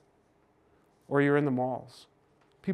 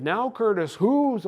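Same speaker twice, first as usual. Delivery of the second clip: raised, higher parental scolding voice; sounding like dad; 7 semitones higher, 5 dB louder, slower than usual. Retold or imitated. Son to littering friend, in dad's scolding voice. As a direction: son catching himself echoing dad's reprimand. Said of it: "I could hear your voice".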